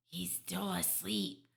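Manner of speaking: usual speaking voice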